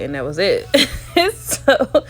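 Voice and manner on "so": high burst of laughter; laughing. Real, not acted. Laughs at his lie.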